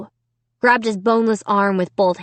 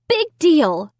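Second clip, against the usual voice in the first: usual speaking voice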